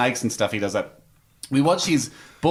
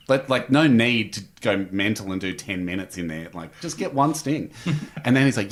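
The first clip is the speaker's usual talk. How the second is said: ordinary talk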